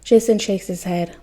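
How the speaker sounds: ordinary delivery, nothing marked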